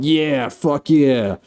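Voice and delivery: high, drawn-out gung-ho cheer; mock triumphant cheer. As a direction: cheer slowly, hamming up the triumph. Acting character's triumph at zapping.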